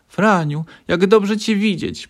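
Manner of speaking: high, loud and slow; joyful greeting. A narrator's reading. Mother greets her son home, high and joyful. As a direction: welcome him home with joy. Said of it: "powitała go mama".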